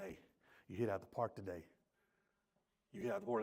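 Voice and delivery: soft praise. Retold or imitated. Wife praising husband softly.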